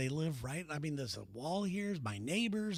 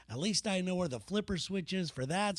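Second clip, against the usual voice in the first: usual speaking voice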